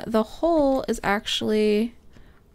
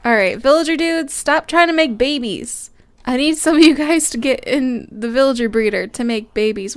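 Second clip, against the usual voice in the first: normal talking voice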